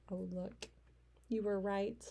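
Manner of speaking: plain reading voice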